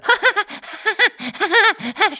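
loud, high, prolonged laugh; uncontrolled high cackling fit; endless laughter. A narrator's reading. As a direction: burst out laughing and keep it going. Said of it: "Śmiech wydawał się nie mieć końca".

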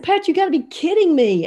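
high-pitched incredulous protest; you can't believe what you're hearing; exasperated disbelief. Retold or imitated. Protesting to Pat in retold argument.

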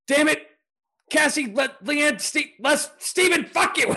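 mock angry mom. Mom mad at her kid, cycling names, high loud mock yell. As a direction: roll through the names like a furious mom. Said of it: "my mom was pissed off".

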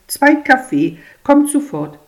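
high and loud waiter; brisk waiter. Acted. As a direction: call the order out like a waiter.

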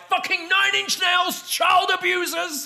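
high shouting drunk imitation; drunken rant. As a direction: yell it like a drunk ranting star. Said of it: "she's like".

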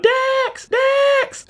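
high-pitched drawn-out scream. Retold or imitated. Ferbus crying out after departing Dex, mock high scream. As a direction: scream the name like you're calling after someone. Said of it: "Ferbus screaming out".

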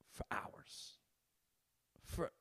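"for hours" breathed out with no voice; whispered. Real, not acted. Telling viewers about late-night TV, whispering.

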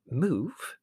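high, puzzled questions; bewildered. A narrator's reading. Mother questioning Larry's plan, bewildered. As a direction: echo it in bewilderment, leaping up in pitch.

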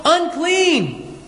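mock cry, pitched high; calling out. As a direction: call it out as a warning, lightly acted. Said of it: "calling out in a loud voice".

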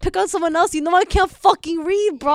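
loud, high-pitched mock pleading; exasperated protest. Poor reader protests being picked by teacher, loud exasperation. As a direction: complain loudly at being picked on. Acted plea to teacher when called on.

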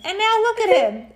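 high, loud gushing mom; proud mom. Acted. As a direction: gush over your grown boy.

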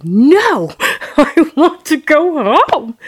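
high, loud childhood refusal, retold; protesting cry. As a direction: plead back like an upset child.

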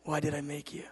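hushed, near-whispered question; God asking softly. Retold or imitated. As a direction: drop to a soft, low-key repeat of the question. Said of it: "And then again he says".

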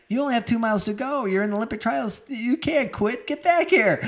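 high-pitched urgent mock yell, climbing at the end; retold yelling. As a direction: re-enact yelling at a runner who's quitting. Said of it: "I start yelling at him".

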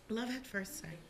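whispered and soft; whispering. Real, not acted. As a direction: breathe it like a secret. Dreamy punchline about meeting her.

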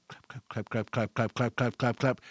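low, rhythmic claps, starting soft and building. Retold or imitated. Storyteller mimicking footsteps behind him in the hall, building rhythmic imitation. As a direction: clap out the approaching steps by voice. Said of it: "you hear those footsteps getting louder".

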